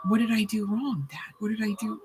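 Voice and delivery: lightly voiced upset child questioning Dad; little boy pleading. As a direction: imitate a bewildered boy, quietly. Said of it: "the boy just kept saying".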